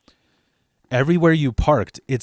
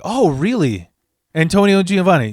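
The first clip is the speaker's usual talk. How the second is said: higher, louder mock-astonished reaction; acted surprise; 7 semitones higher, 6 dB louder than usual. Cashier hears who sent them, acted surprise. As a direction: react as if the names change everything.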